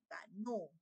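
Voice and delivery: "ganó" end-stressed to show the accent; stress on last syllable. Shown as a demonstration. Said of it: "Coloca la tilde en la sílaba no".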